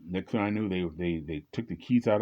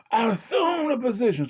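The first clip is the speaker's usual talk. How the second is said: high, loud police command; barking cop; 4 dB louder than usual. Retold or imitated. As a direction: bark it like a cop ordering you. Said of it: "he goes".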